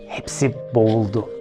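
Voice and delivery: plain reading voice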